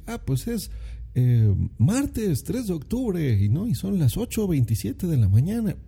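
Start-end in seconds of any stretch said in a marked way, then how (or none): 0.1-3.4 high, slow, over-precise stiff reply; overly formal answer. Pretend. Answering a stranger in an elevator, high, slow and over-formal. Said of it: "no le vas a decir". 3.7-5.8 overly formal answer. Pretend. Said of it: "no le vas a decir".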